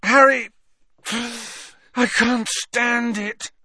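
high, slow, anguished plea; desperate outburst. A narrator's reading. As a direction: burst out in despair, breaking for a breath. Ron's desperate outburst to Harry.

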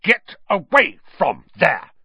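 pompous outraged command; stern order. A narrator's reading. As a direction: order them off, word by word. Percy swelled, orders them away.

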